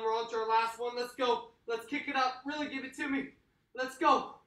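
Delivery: high, loud pumped-up coaching; hype coach. Acted. Workout coach firing up the class, high and loud. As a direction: fire up the class like a trainer.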